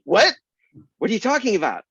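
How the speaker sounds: high, loud, incredulous what; shocked what. Retold or imitated. Author retelling his shock at the secretary's refusal. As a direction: throw out disbelief at the refusal. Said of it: "And I was like".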